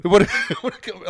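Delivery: high, quick words broken by a laugh. Real, not acted. Telling friends of the thrown baseball, laughing. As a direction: laugh through the repeated line. Laughing over his near miss.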